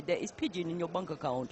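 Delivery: put-on sketch-show prank caller with Nigerian accent; approximate Nigerian accent. Commentator quoting a comedy sketch, approximate Nigerian accent. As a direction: repeat the catchphrase in rough Nigerian. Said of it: "phone people up and just say".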